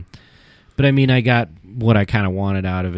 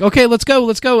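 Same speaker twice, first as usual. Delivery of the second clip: high-pitched, louder mock hustle; retold hurrying orders; 12 semitones higher, 5 dB louder than usual. Staff hurry him away from Stan, mock urgent orders. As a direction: imitate staff rushing someone out.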